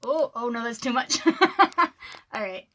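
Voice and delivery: usual speaking voice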